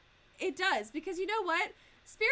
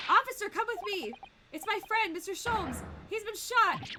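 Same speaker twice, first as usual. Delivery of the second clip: higher-pitched acted alarm; urgent plea; 5 semitones higher than usual. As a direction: call the officer over, raised and urgent. Voicing urgent plea to policeman.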